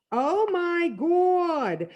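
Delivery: high, loud, long-drawn exclamation; slow, high, gleeful howl; whooping shriek. A narrator's reading. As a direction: whoop it up, stretching every word. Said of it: "he's shrieking and laughing and whooping it up".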